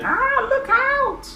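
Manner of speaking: very high, slow warning; alarmed cry. Acted. Girls warn each other, squealing. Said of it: "These girls are going".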